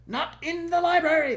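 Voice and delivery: high, loud shout of alarm; commanding shout. Acted. King and princess forbid the servants the library, loud. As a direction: bellow it as an order. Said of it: "shouted in one voice".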